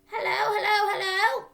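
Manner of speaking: high, loud squawky parrot voice; parrot calling. Acted. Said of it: "it said".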